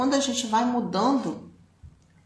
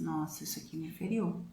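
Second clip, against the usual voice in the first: quiet hurt admission; 2 semitones lower, 10 dB quieter than usual. Retold or imitated. Realizing an old hurt.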